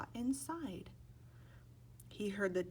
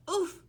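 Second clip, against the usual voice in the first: oof sound; 11 semitones higher, 8 dB louder than usual. A narrator's reading. Sound effect in read-aloud.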